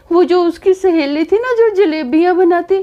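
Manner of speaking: high, weepy explanation; imitating a tearful mother-in-law. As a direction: sniffle through it. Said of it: "आप serial के लिए रो रहे हो?".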